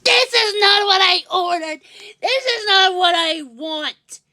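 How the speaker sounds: mock-indignant, pitch up high; half-hammed angry dad; retold angry outburst. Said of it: "He was like".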